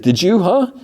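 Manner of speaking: high-pitched, eager questioning; acted-out bluff with a rising huh. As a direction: press for agreement, pitch shooting up. Bluffing driver presses for agreement.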